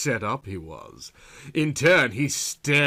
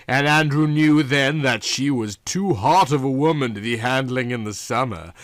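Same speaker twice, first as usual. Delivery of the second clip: ordinary delivery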